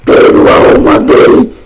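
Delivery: loud, rough demon voice demanding a soul; growling monster voice. Pretend. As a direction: snarl it like a hungry demon. Voz de monstro no jogo. (voz de demônio rosnando, exigindo a alma)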